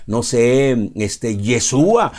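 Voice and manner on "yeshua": "Yeshua" drawn out slowly, stressed on the U; Hebrew-style name. Shown as a demonstration. Preacher cites what many would say, slow Hebrew-style pronunciation. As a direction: stretch "Yeshua" out as the alternative name. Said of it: "si nos basamos a cómo se pronuncia".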